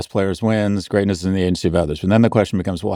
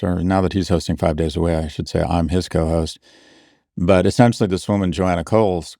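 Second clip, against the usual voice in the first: usual speaking voice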